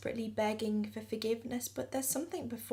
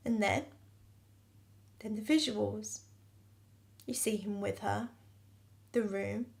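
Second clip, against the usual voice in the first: normal talking voice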